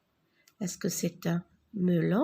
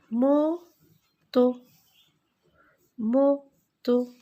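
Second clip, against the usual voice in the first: loud model word; 3 semitones higher, 6 dB louder, much slower than usual. Shown as a demonstration.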